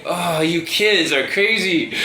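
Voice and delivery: usual speaking voice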